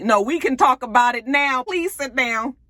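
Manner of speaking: imitating an eager husband; mock insistent. Husband insisting she sit.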